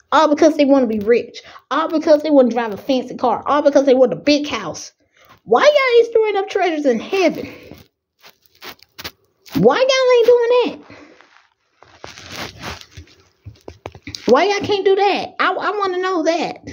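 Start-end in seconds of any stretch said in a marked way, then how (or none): 5.4-16.6 high, pleading preacher's challenge; fervent preaching. Real, not acted. Preacher pleading with the congregation, voice raised high. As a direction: press the congregation, pitch way up.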